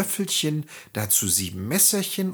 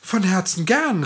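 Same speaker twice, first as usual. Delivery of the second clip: glad acceptance; 7 semitones higher, 4 dB louder than usual. A narrator's reading. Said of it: "rief Schneewittchen".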